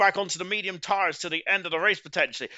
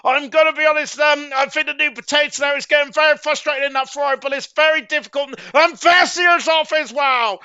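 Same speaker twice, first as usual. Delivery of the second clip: excited shout; 8 semitones higher, 8 dB louder than usual. Real, not acted. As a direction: erupt at the drama on track. Dramatic overtaking, commentator erupts.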